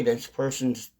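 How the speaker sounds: ordinary delivery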